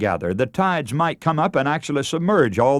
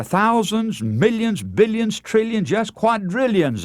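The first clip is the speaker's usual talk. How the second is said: building emphasis; 8 semitones higher than usual. Real, not acted. Preacher piles up ever larger numbers.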